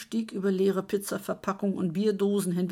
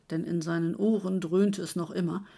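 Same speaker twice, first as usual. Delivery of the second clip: ordinary delivery, nothing marked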